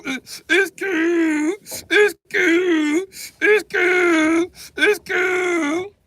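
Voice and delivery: high wailing, breaking into sobs; fake-sob the words; mock crying. Telling himself it's fine while mock crying.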